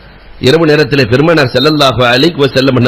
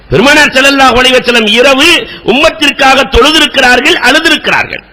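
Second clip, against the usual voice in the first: fervent preaching; 9 semitones higher than usual. Acted. Preacher retelling Bilal's question to the congregation, impassioned. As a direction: preach it with rising fervour.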